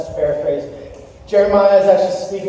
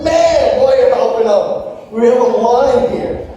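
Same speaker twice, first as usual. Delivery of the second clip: higher, quick exasperated complaint; indignant protest; 3 semitones higher, 3 dB louder than usual. Retold or imitated. Pastor replaying his gripe at the cashier leaving the line, mock indignation. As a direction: protest as if wronged, playing it up.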